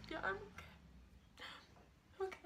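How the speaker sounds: high, soft, drawn-out mock-weepy reply; imitated tearful. Telling him she's okay after crying, mock tearful. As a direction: squeeze it out tiny, as if trying not to cry.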